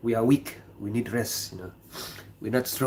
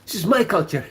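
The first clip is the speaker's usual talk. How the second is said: high-pitched colleague voice; 7 semitones higher, faster than usual. Retold or imitated. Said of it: "quoting colleague again".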